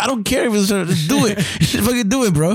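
high, laughing imitation of a rude customer; laughing mock bully voice; mock pushy customer. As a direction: mimic the French guy's pushy demand. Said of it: "He's like".

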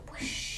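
soft, voiceless rushing sound; imitated magical rush of air; portal whoosh. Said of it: "went the portal".

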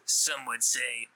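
slow, ominous boast; slow grandiose villain. Pretend. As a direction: drag it out like a mastermind.

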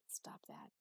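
hushed whisper under the breath. Acted. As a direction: tell her off in a whisper so the doctor can't hear. Marcus nudges her at the desk.

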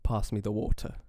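imitated British accent, long AH in "Pass"; careful try at English "water" with a clear T; attempted English accent. Shown as a demonstration. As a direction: copy the English accent, evenly. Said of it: "try to do an English accent".

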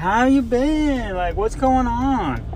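high, slow fake-friendly greeting; hammed-up sweet hygienist voice; mock cheery dentist. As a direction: play the chirpy dentist pretending to care. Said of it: "and they're like".

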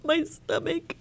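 breaking up, high and hushed while retelling; reliving the news, voice strained and unsteady; real distress. Real, not acted. Telling the interviewer how she fell apart, voice strained and high. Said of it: "I lost it".

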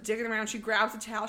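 normal talking voice

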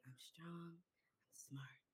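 soft whispered affirmations; child quietly encouraging herself; whispered self-talk. Retold or imitated. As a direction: whisper it to yourself. Overheard daughter talking to herself.

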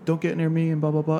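lightly acted high-pitched warning; imitated angry mom. Mom threatening attacker, retold.

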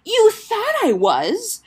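high, loud, drawn-out disbelief; shocked question. Acted. Sister learning he thought her dead, shocked. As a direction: rise sharply, incredulous.

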